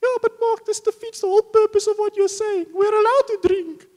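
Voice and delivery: squeaky, very high and loud mock voice; Auntie Sarah. Auntie arguing she is allowed to drink, squeaky protest. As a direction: protest in a silly falsetto. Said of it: "It's, it's Auntie Sarah".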